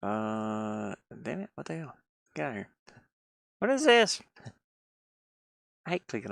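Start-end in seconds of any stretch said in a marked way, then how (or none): none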